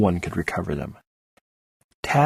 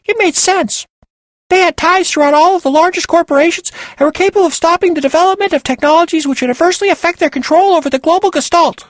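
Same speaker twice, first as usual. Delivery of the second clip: very high, loud and fast squeaky voice; helium squeak; 7 dB louder, faster than usual. Acted. Penny explaining Muncie's theory, fast high squeak. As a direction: rattle off the conspiracy in a chipmunk squeak.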